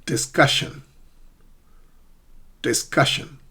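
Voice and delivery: "discussion" said alone, CUS stressed; isolated "discussion", middle syllable strong; careful word model. Shown as a demonstration. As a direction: pronounce "discussion" distinctly for learners to repeat. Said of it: "I'm going to pronounce these seven words".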